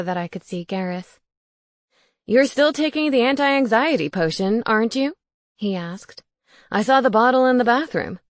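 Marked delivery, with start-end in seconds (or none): 2.3-8.2 ghost roommate voice. Acted. Gareth questioning.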